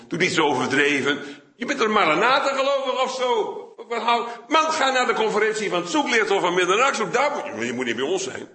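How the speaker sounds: hammed-up derision, half laughing; mocking scorn. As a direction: church leaders scoff at the seeker, high mocking laughter. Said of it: "ik sla een beetje door".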